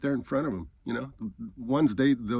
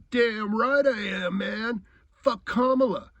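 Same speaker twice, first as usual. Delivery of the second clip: mocking high, drawn-out imitation of his boast; defiant Trump supporter; 9 semitones higher, 3 dB louder, slower than usual. Man answering about being a Trump supporter, mocking defiance. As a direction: mimic him, slow, high and proud.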